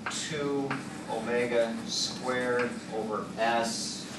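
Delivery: ordinary talk, nothing marked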